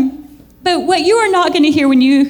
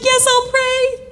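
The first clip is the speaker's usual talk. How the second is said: eager yes; 11 semitones higher, slower than usual. Retold or imitated. Charmin's reply.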